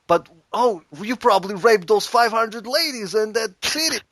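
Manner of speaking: high-pitched, awed fellow inmate; impressed prisoner. Acted. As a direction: gush at a notorious newcomer. Same inmate keeps guessing.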